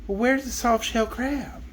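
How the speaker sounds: put-on soft, slow girlish question; little girl voice. Dorothy asking the zebra, soft and slow. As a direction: ask gently like a small girl.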